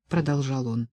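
plain reading voice

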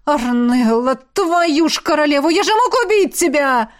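anguished cry climbing in pitch; distraught scolding of a loved one; horrified outburst. A narrator's reading. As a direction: build to a high, appalled cry. Horrified at almost killing her.

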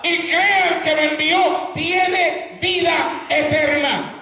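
high-pitched, fervent pulpit proclamation; full-force preaching. Acted. Preacher urges congregation to accept Christ, full-force preaching. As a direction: cry the word out with long, stretched key phrases.

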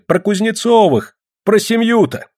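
high, eager crowd cry; women's calls. A narrator's reading. Village women urge Ksenia on, crying out. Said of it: "кричали бабы".

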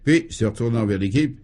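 ordinary delivery

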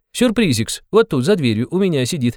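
high teasing reveal with a titter; impish, high sing-song; gleefully playful interrogator. A narrator's reading. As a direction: savour the surprise, then giggle. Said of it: "становился всё веселее и игривее".

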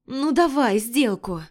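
slow pouting whine; whiny spoiled pouty drawl. A narrator's reading. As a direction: drag it out like a spoiled child. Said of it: "произношу капризным голосом".

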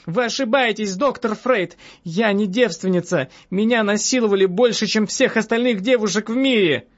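high-pitched, indignant woman; agitated high outcry without full shouting; crying out in protest. A narrator's reading. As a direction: insist hotly, pitched well up. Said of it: "закричала я".

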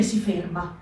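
ordinary delivery

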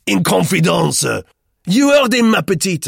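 loud, high, scornful repetition; mocking outrage. A narrator's reading. Roux appeals to Mademoiselle Martin, mocking. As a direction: throw his words back, loudly. Roux mocks Duclos to girlfriend.

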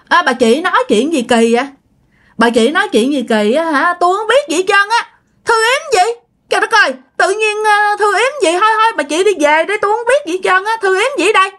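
high, feigned-innocent protest; indignant old man. Acted. Tư to Tám, denying the spell, in a high indignant voice. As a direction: pitch way up and play dumb, shooing her off. Tư brushing off Tám's accusation.

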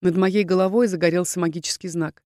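ordinary delivery, nothing marked

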